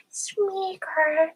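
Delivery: high-pitched creature voice; high, drawn-out creature cry; Gollum. Acted. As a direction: squeak the name as a wretched creature. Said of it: "Gollum then".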